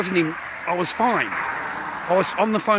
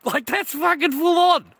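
much higher, louder and slow; outraged shout; 12 semitones higher, 6 dB louder than usual. Real, not acted. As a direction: drag out the outrage. Ranting at ex over seized pay.

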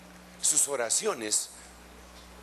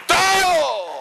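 preaching shout; 13 semitones higher, 10 dB louder than usual. Real, not acted.

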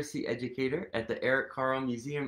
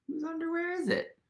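higher, fast playful question; bubbly, curious question; excited question; 11 semitones higher, faster than usual. Acted. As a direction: tease the kids with the mystery.

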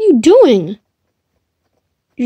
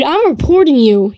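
usual speaking voice

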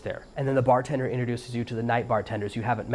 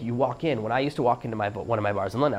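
ordinary delivery

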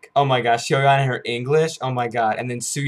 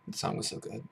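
hushed, barely audible awe; overwhelmed whisper; 14 dB quieter than usual. Real, not acted. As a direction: whisper it as if lost for words. Overwhelmed by the song.

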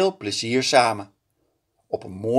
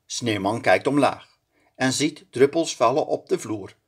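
ordinary delivery

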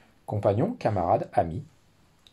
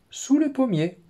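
high, loud call from under the tree; hidden voice; 6 dB louder than usual. Acted. Teacher voicing the hidden fox, high and loud. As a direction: sing out from hiding. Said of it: "dit la voix".

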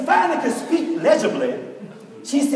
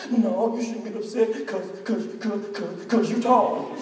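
much softer, halting sobbed answer; mock sniffling sob; 6 dB quieter than usual. Crying girl answering her teacher, soft halting sobs. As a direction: stammer the words out between sobs, trailing quiet. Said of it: "Stop crying for just a minute".

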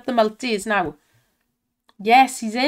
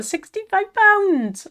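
high, drawn-out excited cry; real thrilled shout; excited win call; 10 semitones higher, 5 dB louder, slower than usual. Real, not acted. Big win on the slot.

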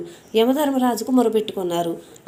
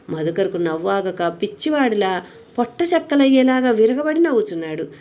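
ordinary delivery, nothing marked

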